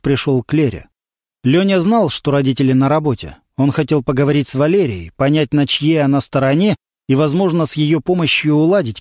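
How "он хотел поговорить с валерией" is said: usual speaking voice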